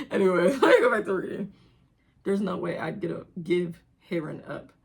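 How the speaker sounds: ordinary talk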